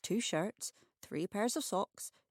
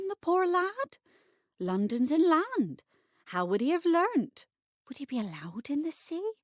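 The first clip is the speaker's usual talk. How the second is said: higher, louder anxious questions; fretting worry; 8 semitones higher, 6 dB louder than usual. Acted. Peggy worrying whether the boy can swim, higher and louder. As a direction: fuss over the poor boy.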